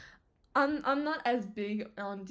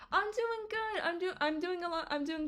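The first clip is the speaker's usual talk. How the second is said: much higher, bright reply; high happy; 8 semitones higher than usual. Real, not acted. She answers her friend, high and happy. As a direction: answer happily.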